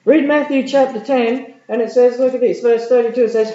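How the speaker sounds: high, loud, excited preaching; preaching at full force. Acted. Preacher rousing the congregation with a big, high, loud voice. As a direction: lift the crowd, rising high and loud.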